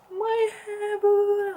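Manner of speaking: high, loud wail dissolving into boo-hoo; hammed-up bawling; mock crying. Telling mum about the ruined hair, wailing mock cry. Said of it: "I start crying".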